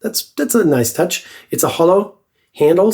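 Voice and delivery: ordinary talk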